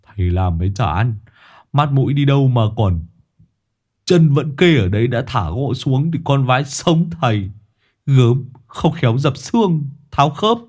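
character voice, tutting reproach; tutting workman. A narrator's reading. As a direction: chide the injured man with grumbling concern. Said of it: "thì chét miệng".

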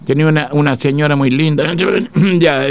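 usual speaking voice

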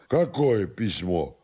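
soft, tipsy denial; drunken stubborn servant. Acted. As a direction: deny it quietly and doggedly, drunk. Drunken servant denies letter.

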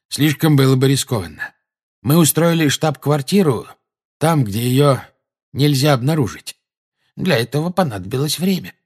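explaining wizard, earnest. A narrator's reading.

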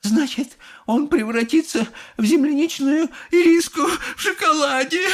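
very high-pitched tearful wail; inconsolable sobbing. A narrator's reading. Mother fearing for her son, sobbing. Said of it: "зарыдала безутешная миссис Глуп".

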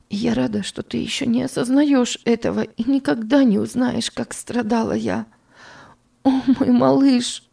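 put-on high, pained words to the baby; a mother grieving over her child; grieving tenderness. A narrator's reading.

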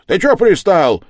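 loud, high outburst; angry challenge. Acted. Yard-keeper confronts the pestering stranger, angry shout. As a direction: snap at a pest at full voice.

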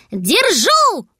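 high, loud cry of victory; triumphant shout. Acted. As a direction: yell it out proudly. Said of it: "хором закричали".

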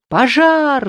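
high loud yell of many throats; crowd roar. Acted. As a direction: bellow it out. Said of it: "заорали десятки глоток".

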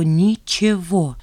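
"ничего" stretched very slowly, syllable by syllable; "ничего" said slowly for finality; emphatic drawn-out word. A narrator's reading. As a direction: draw out "ничего" with slow, deliberate weight. Bitter realization, repeated for emphasis.

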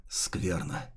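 breathy unvoiced whisper; whispered thought. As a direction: hiss it under your breath. Neli's inner thought, danger.

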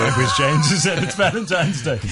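laughing while protesting. Real, not acted. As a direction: say it through laughter. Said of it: "laughing denial".

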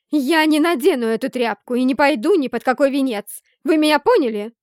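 loud, high, furious refusal; furious shout. A narrator's reading. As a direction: scream it in her face. Heroine refuses the wedding, furious. (яростный крик)

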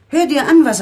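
sharp scolding. Acted. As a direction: snap at him impatiently. Mother scolds sulking son. (scharfer, lauter Tadel)

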